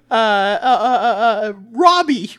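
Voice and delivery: put-on high, loud little boy; high kid voice.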